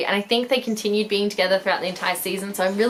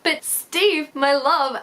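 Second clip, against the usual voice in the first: mock lovesick plea, pitched higher; dramatic swoon; 7 semitones higher, much slower than usual. Pleading for Steve, hammed-up lovesick cry. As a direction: ham up a heartbroken lover. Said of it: "I'm like".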